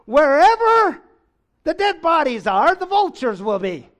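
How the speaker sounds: high, booming mock-solemn answer; comically over-loud pronouncement; hammed-up proclamation. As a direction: start very high and loud, then come down. Booms Jesus' baffling answer for laughs.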